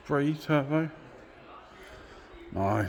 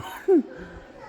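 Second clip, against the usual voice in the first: high breathless giggle of delight; giddy laugh; 3 dB louder than usual. Real, not acted. Overjoyed among the classic cars, high giddy laugh.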